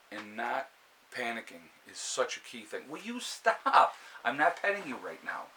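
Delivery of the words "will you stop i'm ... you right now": high, exasperated "stop it"; scolding the dog. Real, not acted. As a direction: tell the pushy dog off.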